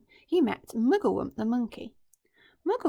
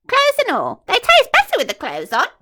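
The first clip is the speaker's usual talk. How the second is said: much higher, louder wicked relish; gloating crocodile; 12 semitones higher, 14 dB louder than usual. Acted. Crocodile gloating to the monkey, high and wicked. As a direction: lick your lips as you say it.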